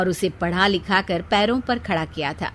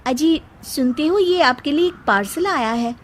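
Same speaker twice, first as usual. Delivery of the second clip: high, lilting call to her husband; sweet calling wife; 5 semitones higher than usual. Acted. Seema calling her husband about a parcel. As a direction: call him over, all sweetness.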